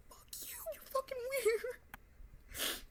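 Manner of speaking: slow whisper, pitch soaring; whispered insult. Acted. To the creep watching, a slow whispered insult. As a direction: hiss it at the viewer slowly. Addressing the creep via camera.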